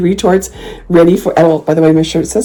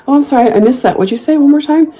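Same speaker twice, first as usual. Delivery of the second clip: high-pitched innocent questioning; feigned innocence; 10 semitones higher than usual. Acted. Answering a body remark, acting all innocent. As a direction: play dumb in a high, sweet-sorry voice. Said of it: "Just act all innocent".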